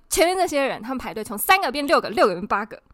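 reporting line cutters to staff, loudly; loud complaint. Retold or imitated. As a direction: call them out for all to hear. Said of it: "然后我超大声".

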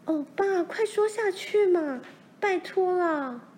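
put-on high-pitched, pleading girl's voice; impatient cry. A narrator's reading. Daughter begs father to go on, crying impatiently. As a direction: beg urgently, drawing out the last plea. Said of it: "罗兰急得叫起来".

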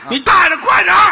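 尖声大喊催促; 学对面玩家扯着嗓子吼; 耳麦里的喊叫. Retold or imitated. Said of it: "打那耳麦里听对面喊". (拔高嗓门大声吼)

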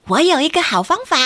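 put-on high, quick child's voice. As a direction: chirp it like a small kid. Child character voice in story.

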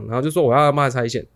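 normal talking voice

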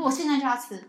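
put-on loud eager child's demand; the child wanting cookies now; eager kid. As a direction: say it like an impatient kid.

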